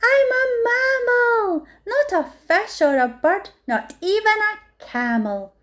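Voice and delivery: proud to finally know; excited discovery. A narrator's reading. Fluffalump proudly announces what it is, high and joyful. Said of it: "shouted Fluffalump".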